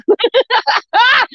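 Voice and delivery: high giggling burst; laughing. Real, not acted. Tickled by her own posh attempt, high laughter.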